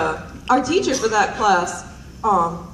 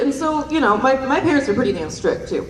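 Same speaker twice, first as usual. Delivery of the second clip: normal talking voice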